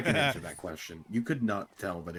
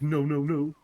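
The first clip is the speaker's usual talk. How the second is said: mock protest; 8 semitones higher, 7 dB louder, slower than usual. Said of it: "He was like".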